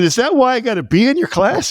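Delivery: high teasing question; mock suspicion. Asking the teacher about his grade, high teasing. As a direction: tease it up high. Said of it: "I said".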